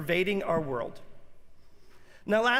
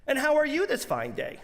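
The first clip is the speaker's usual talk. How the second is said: high, sing-song cashier greeting; bright, high customer-service voice; chirpy cashier; 8 semitones higher than usual. Retold or imitated. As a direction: play the overly friendly cashier. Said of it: "she says, this woman".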